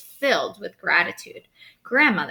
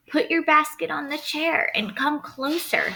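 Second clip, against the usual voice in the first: wolf faking a sweet grandmother voice, higher; 7 semitones higher than usual. A narrator's reading. Wolf luring the girl to the bed, sickly sweet. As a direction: coax her closer, sugary and false. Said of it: "he said as sweetly as he could".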